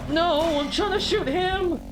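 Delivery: high panicked yell; frantic shout. Real, not acted. Panicked during combat.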